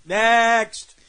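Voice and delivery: barked-out next; a sharp loud call to move on. Acted. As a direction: call the next one loudly. Dismissing a bad drawing loudly.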